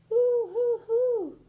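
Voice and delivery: put-on high, loud hooting baby wail; baby crying sound. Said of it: "A child was crying".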